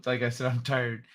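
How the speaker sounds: usual speaking voice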